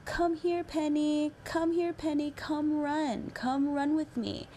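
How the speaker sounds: put-on high, slow little boy calling; imitating a small boy calling his sister. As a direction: call sweetly like a toddler. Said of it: "he kept saying".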